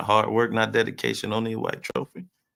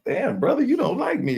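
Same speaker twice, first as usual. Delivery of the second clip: mock hurt; 4 dB louder than usual. Mock hurt at being rejected.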